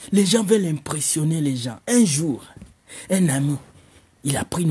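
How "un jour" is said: ordinary delivery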